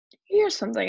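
usual speaking voice

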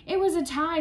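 high, loud call from the crowd; shouting. A narrator's reading. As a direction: shout it across the playground. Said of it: "someone shouted".

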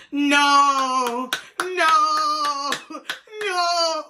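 hammed-up wailing refusal; meme-style cry of no. Storyteller inserting a wrestler meme, drawn-out mock wail. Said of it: "we have to insert the meme".

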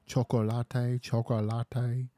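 sing-song mimicry of a street seller hawking "chocolate"; mock Spanish vendor call.

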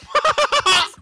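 loud laugh. Real, not acted. Big laugh at banana hat.

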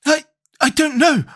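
high, stammered reply; pained distress. Acted. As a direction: falter in a very high, suffering voice. Boy still in acted pain.

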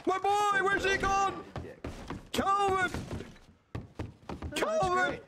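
high, frantic calling out for Calvin; calling for lost son. Acted. Father searching for vanished son.

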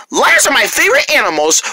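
ordinary delivery

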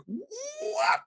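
very high squeal rising to a shout; shocked disbelief. Retold or imitated. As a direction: re-enact stunned disbelief, voice shooting way up. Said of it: "I legitimately was like".

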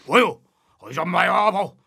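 ordinary delivery

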